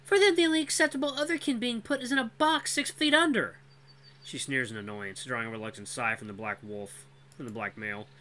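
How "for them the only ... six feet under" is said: high, bitter female voice; bitter young woman. Acted. Cora explains bitterly.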